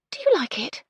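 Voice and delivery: a sudden high blurt. A narrator's reading. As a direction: let it burst out before she can stop. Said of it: "then blurted out".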